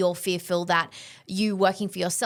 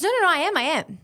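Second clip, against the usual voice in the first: defensive protest; 8 semitones higher, 4 dB louder, faster than usual. Retold or imitated. Friend protesting when challenged in retold conversation.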